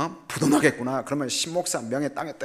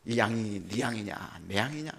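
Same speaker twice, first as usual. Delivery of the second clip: voice of the Spirit; 3 semitones lower, 6 dB quieter than usual. Retold or imitated. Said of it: "성령께서 그러시더라고요".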